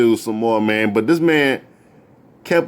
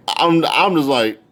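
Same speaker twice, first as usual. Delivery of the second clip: usual speaking voice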